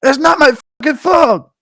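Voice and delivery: high, loud, throat-straining yell impression; Marvin New York. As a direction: yell it at the top of the voice. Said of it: "Marvin New York impression".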